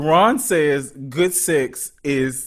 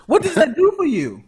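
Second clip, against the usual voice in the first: high, loud incredulous question; baffled disbelief; 13 semitones higher, 5 dB louder than usual. Real, not acted. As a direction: demand an explanation, voice pitched up. Baffled reaction to friend's confession.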